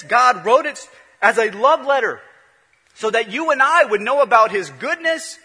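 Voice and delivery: rising from firm to loud and high preaching; building preaching. Acted. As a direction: build each repeat like a swelling sermon. Preaching builds to full force.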